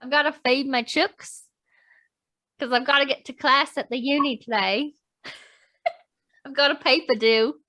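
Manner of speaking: put-on Aussie student chat; Australian accent with lilting phrase ends; chatty Aussie surfer talk, broken by giggles. Shown as a demonstration. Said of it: "Okay, [name], your turn".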